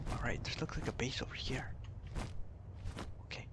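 soft unvoiced whisper. Real, not acted. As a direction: hush it like sneaking up. Sneaking up near an enemy base.

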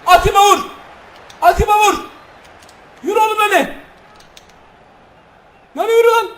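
high, loud shouts at the player; excited yelling. Real, not acted. Gamer screaming at striker Atiba to shoot, loud. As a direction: scream him toward goal.

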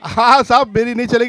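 loud, high retort; heated shouting. Real, not acted. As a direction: shout back over the uproar. Host shouting amid argument.

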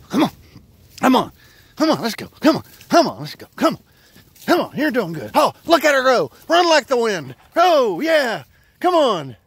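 high, loud calling; urging shout. Real, not acted. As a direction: shout the dog on. Urging dog on run.

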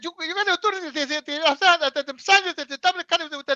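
high, loud stammering worker voice; mock drive-through worker. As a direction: stutter through it in a high put-on voice.

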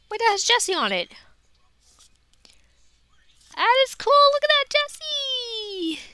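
high, delighted squeal at the card; thrilled fangirl squeal; excited squeal. Real, not acted. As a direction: gush over the find, pitch way up. Finds a Jessie card.

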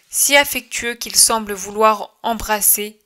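ordinary delivery, nothing marked

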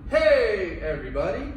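loud, bright call to freeze; cheerful shouted signal; yelled call. Shown as a demonstration. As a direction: call it out to the whole room. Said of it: "I'm gonna yell out".

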